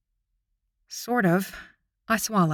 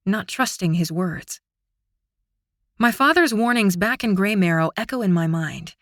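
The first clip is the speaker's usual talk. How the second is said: usual speaking voice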